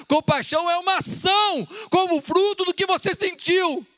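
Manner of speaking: preacher's climactic cry; preaching shout. Acted. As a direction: drive the point home, full voice.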